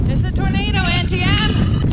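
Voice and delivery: high, loud panicked cry; dramatic movie heroine. Acted. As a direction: wail it like a frightened farm girl. Dramatic movie quote about wind.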